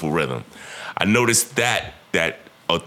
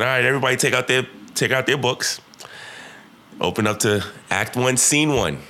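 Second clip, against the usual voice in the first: teacher commanding class; 6 semitones higher than usual. Retold or imitated. Teacher addressing rowdy class.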